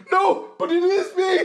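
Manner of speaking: high, fast mock shout; hammed-up yell of outraged protest; mock indignant protest. Imagined culprit insisting on taking the blame, mock indignant shouting.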